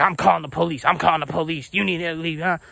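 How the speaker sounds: hammed-up coworker's agitated rant; mock yelling. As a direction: imitate a worked-up woman making threats. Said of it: "She's yelling so much in my face".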